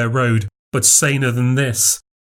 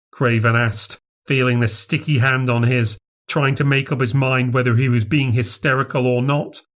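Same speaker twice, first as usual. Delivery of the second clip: plain reading voice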